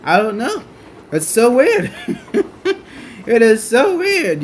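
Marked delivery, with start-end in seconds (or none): 1.1-4.4 high laughing disbelief. Real, not acted. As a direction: laugh through the words. Laughing at own worry.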